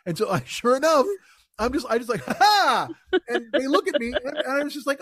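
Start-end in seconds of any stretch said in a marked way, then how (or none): 2.2-2.9 laughing. Retold or imitated. Retold laughing again.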